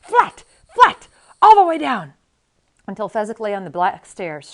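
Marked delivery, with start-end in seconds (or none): 0.0-2.1 high, loud urgent shouts; cried command. A narrator's reading. Inigo slams Fezzik down again, urgent high shouting. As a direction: cry out each order forcefully.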